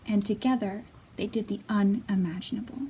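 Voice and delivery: put-on lively read-aloud for small children; storybook reading. A narrator's reading. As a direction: read it like storytime, bright and expressive. Reading picture book to children.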